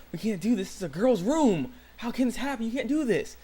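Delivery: indignant mock protest, pitch climbing; retold protest. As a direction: play up a scandalized objection. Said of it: "Shoma is like".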